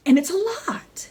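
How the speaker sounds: breathy hushed whisper on the line; whispered aside. Real, not acted. Vlogger whispering to infertile viewers how hard it is. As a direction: drop to a whisper to stress the weight.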